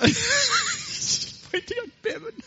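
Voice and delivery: real laughter breaking up the words; laughing. Real, not acted. Preacher amused by someone in the congregation, laughing. Congregation joke interrupts the sermon.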